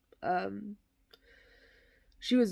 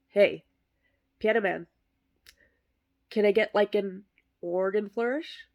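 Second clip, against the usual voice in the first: loud call to the pianist; showman's loud request; 5 dB louder than usual. Acted. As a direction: project it across the hall. Calling out to pianist.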